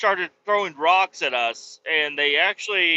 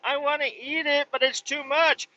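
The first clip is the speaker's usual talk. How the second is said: put-on high childish whine with a mouthful; imitating his son; 9 semitones higher than usual. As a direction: mimic a little boy whining over too much steak. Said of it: "he's like".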